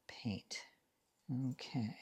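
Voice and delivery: whispered. Real, not acted. Reminding herself, trailing off.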